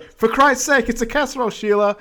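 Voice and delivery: husband losing patience with his wife on the phone; exasperated. Retold or imitated. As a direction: snap at the wife in a hammed-up rant. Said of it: "It's like".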